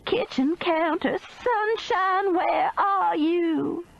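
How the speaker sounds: high, slow crying call; frightened calling. Acted. Meemaw calls the missing woman, crying. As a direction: call out near tears.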